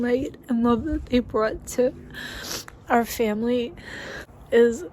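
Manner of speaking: tearful, choked-up voice; crying while trying to talk; blubbering. Real, not acted. Crying over the lost dogs.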